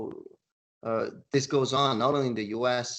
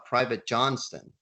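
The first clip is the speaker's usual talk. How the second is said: ordinary talk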